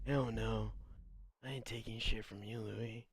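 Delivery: a girl acting hard at Louis, voiced low and soft; mock tough girl. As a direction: growl it quietly like a wannabe tough girl.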